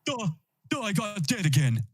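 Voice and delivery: lightly voiced dim cartoon kid; dopey cartoon voice. Quoting dim character from episode.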